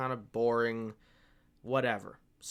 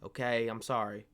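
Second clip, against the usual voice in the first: ordinary delivery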